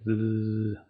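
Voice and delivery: d- as a voiced, buzzing consonant sound; sonido D sonoro. Shown as a demonstration. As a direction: let the d- vibrate in the throat. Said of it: "si yo pronuncio D". (d- con vibración en la garganta)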